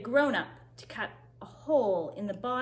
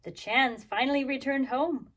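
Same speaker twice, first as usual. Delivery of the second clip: usual speaking voice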